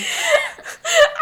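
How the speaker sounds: a shrill burst of laughter, half crying; high laughing cry. Real, not acted. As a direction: laugh it out high, almost sobbing. Laughing at own story.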